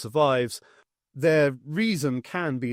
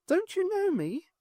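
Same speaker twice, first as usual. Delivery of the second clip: put-on ghost girl; 4 dB quieter than usual. A narrator's reading.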